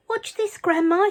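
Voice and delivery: put-on high, loud boyish call; shouted. A narrator's reading. George calling up to Grandma on the roof, shouting. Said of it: "he shouted".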